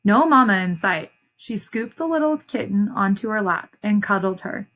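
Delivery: usual speaking voice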